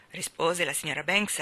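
usual speaking voice

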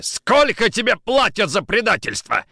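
high, loud, forceful question; furious accusation. Acted. Nikitin gripping Kochkin, furious accusation. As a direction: demand an answer in fury. Officer accusing man of treachery.

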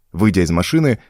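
ordinary delivery

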